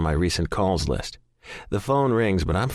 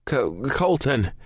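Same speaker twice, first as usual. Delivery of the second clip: slow stuttered name; stuttering; 6 semitones higher, slower than usual. A narrator's reading. Shaken caller stuttering his name to operator, stutter. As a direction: stumble on his own name. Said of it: "I stutter out".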